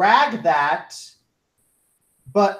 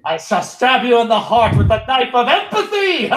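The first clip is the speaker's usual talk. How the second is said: mock villain; 4 semitones higher, 5 dB louder than usual.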